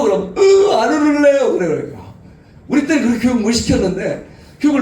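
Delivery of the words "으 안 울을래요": put-on sniffling child. Child answering mother, sobbing.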